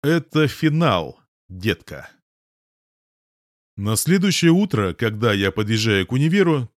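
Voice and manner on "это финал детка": usual speaking voice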